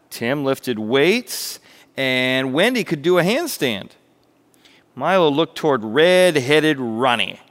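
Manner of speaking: animated storyteller, projected and expressive; story narration. A narrator's reading. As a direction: tell it like a lively children's tale. Narrates a parable.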